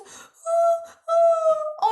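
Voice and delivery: high, wailing pretend sobs; exaggerated make-believe weeping; mock crying. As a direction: fake boo-hoo moans. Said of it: "pleurer, voilà, c'est ça".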